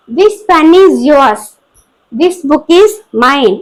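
slow dictation. Shown as a demonstration. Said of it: "teacher reads example sentences".

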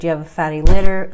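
ordinary delivery

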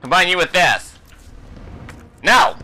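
normal talking voice

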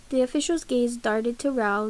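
plain reading voice

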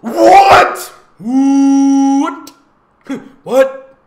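loud, high repeated what; mock shock. Host reacting to the story twist, loud shocked what.

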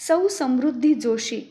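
usual speaking voice

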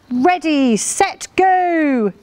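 high, ringing race starter's call; excited starting call. A narrator's reading. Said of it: "he heard Farmer Tom call".

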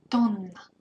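Тонна with a held NN, TON-nna; teacher stretching the double N; long double N. Shown as a demonstration. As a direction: prolong the N in Тонна. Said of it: "Be sure to prolong N here".

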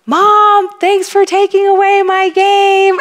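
put-on high, loud five-year-old calling out; imitated child's yell. Muddy five-year-old calls to mom while playing, happy yell. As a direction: yell it up high like a little kid. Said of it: "my five-year-old yells out".

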